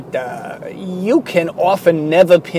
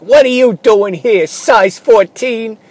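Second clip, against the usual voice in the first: louder, higher, slowed mocking challenge; indignant challenge; 7 semitones higher, 7 dB louder, slower than usual. Little guys confronting the tall shopper, loud mocking challenge. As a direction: call out the giant.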